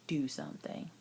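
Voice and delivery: soft embarrassed whisper. Real, not acted. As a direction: drop to a whisper, shy. Embarrassed whisper about bowel.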